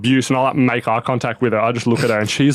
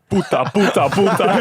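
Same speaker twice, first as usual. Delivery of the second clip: higher, repeated mock Spanish swearing; hounding Spanish heckler; 7 semitones higher than usual. Angry fan swears at player after phone flips, mock hounding. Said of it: "getting hounded in Spanish".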